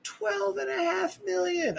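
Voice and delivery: high, slow "twelve and a half million"; drawn-out disbelief. Real, not acted. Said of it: "repeated, drawn out high".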